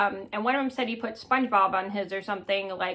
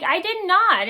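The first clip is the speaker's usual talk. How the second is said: put-on higher, louder protest, like a hurt kid; indignant denial; 8 semitones higher, 6 dB louder, slower than usual. To the teasing classmates at school, an indignant higher, louder denial. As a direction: insist hard that you never said it.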